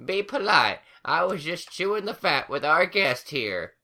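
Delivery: put-on high old granny scolding. A narrator's reading. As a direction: tell the grandkid off. Granny Smith figure voice.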